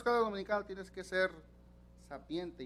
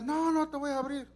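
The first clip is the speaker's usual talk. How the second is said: angry wife; 8 semitones higher than usual. Retold or imitated. Wife refuses through the door.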